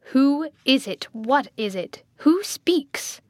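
loud, urgent questioning; agitated demand. A narrator's reading. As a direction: demand to know, leaping high on the last question. Blind man demands who is there.

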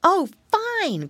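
high-pitched happy exclamation; delighted cry. A narrator's reading. Piggy boy welcomes the island trip, crying out happily. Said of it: "cried Curly".

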